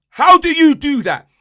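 fed-up fan yelling at the captain; exasperated shout. Real, not acted. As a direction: throw the question at him in disbelief. Ranting fan repeats, louder.

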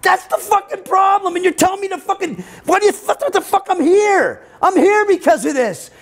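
high-pitched exasperated shouting; tearful outburst. Retold or imitated. Patient in tears yelling at the psychiatrist, desperate outburst. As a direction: shriek it as if at the end of your rope. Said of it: "I'm like in tears".

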